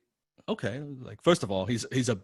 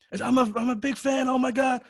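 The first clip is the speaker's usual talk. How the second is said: high starstruck gushing; gushing fan; 14 semitones higher than usual. Retold or imitated. Fan repeating his gush to the writer, high squeal.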